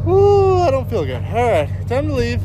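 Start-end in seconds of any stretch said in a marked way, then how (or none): 0.0-0.6 loud woo. Real, not acted. Relief after long job.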